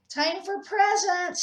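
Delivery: high, slow, loud call; yelled. A narrator's reading. Clem calling the monsters to open gifts, yelling. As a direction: call everyone to the presents. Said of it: "he yelled".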